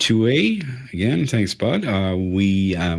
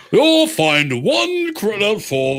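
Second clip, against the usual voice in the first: put-on slow, high, plummy British accent; really posh British; 9 semitones higher, slower than usual. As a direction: draw it out like Buckingham Palace British. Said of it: "He sounds really posh".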